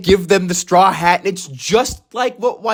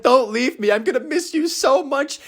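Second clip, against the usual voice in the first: higher, whiny imitation of a crying boy; mock tearful plea; 5 semitones higher than usual. Fan voicing Momo hugging Luffy goodbye, mock tearful plea. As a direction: plead it lightly, pitched up. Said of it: "he starts crying and he's like".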